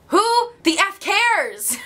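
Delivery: high, drawn-out exasperated cry; emphatic dismissal. Real, not acted. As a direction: throw it out high and slow, not caring. Emphatic dismissal of weight worries.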